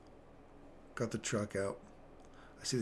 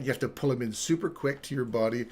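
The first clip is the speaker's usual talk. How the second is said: usual speaking voice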